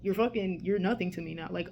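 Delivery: ordinary talk, nothing marked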